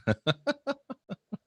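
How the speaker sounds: high, light laugh. Real, not acted. Host laughs at chat comments.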